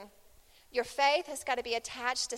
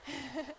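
soft laugh; 8 dB quieter than usual. Real, not acted.

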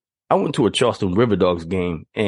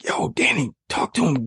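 urging friend; 5 semitones higher than usual. Retold or imitated. Friend urging him at shoulder.

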